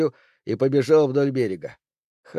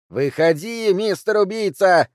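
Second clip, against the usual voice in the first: high, loud calling yell; 8 semitones higher, 5 dB louder than usual. A narrator's reading. Woman yells for the killer to come out. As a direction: howl for him to come out. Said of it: "вновь завопила она".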